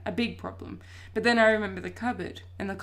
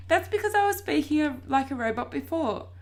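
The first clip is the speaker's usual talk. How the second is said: a hint of high-pitched robot; 7 semitones higher, 4 dB louder than usual. A narrator's reading. Boy explaining to his sister, slipping into robot voice. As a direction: lightly put on a robot voice. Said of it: "you said it like a robot".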